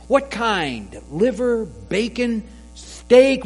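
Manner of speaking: high, rising shopkeeper voice; playful butcher, loudest on steak; butcher asking. Acted. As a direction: list the meats brightly, building to steak. Butcher offers the dog meat.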